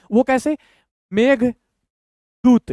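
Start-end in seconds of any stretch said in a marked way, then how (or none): 1.1-2.7 very slow, long pause between halves; broken in two. Shown as a demonstration. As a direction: read it in two separate parts. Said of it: "दो भाग में तोड़ के पढ़ेंगे".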